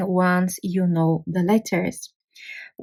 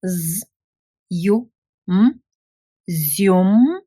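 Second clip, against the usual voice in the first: letters Z, U, M spelled, then joined as "zium"; spelled-out Bulgarian syllable; much slower than usual. Shown as a demonstration. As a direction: sound out then blend "zium". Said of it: "try to read it on its own first".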